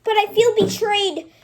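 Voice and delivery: loud, high hurt outburst; loud high betrayed lament; heartbroken outburst. Acted. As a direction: cry out your betrayal. Character pouring out heartbreak.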